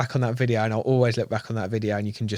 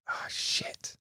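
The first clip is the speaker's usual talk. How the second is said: whispered under the breath; whispered oh shit; 13 dB quieter than usual. Retold or imitated. Angler thinking to himself at dawn, whispered. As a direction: whisper it to yourself in dismay. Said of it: "I'm thinking".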